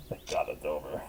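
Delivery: breathless whisper; whispered relief; relieved whisper. Acted. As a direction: sigh it out in a whisper. Relief after painful treatment.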